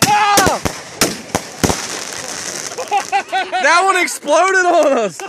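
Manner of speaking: high startled cry, laughing; hit hard crying out. Real, not acted. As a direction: yelp as shrapnel hits. Hit by firework shrapnel.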